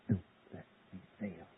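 hushed plea. Acted. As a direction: sink into a quiet plea.

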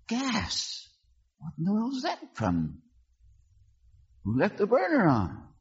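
high, alarmed exclamations; startled brother sniffing gas. Retold or imitated.

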